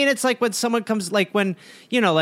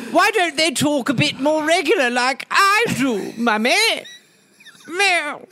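higher, whining mock English prince; petulant royal boy; 5 semitones higher, slower than usual. Young Charles complaining to his mummy, petulant put-on voice. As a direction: play a spoilt English boy-king.

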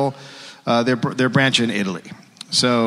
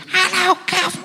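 very high mock Cockney greeting; a bad English accent, chirpy ghost; shitty English accent. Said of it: "stop doing a shitty English impression".

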